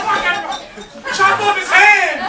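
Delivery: high, loud, slow furious yells; shouting death. Retold or imitated. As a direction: scream for blood at the screen. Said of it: "shouting death to this fucking man".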